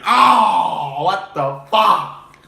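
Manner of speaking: loud, high, drawn-out crowd yell; sideline shout. Retold or imitated. Sideline yelling at the dropped punt, loud and high. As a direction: shout like a whole sideline in disbelief. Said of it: "the whole sideline went".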